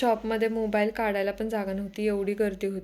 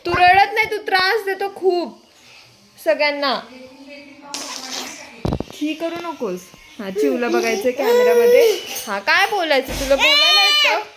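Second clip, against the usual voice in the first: put-on high, loud sing-song cooing to a baby; baby talk; 7 semitones higher, 9 dB louder than usual. Mother talking to her crying baby girl in high baby talk.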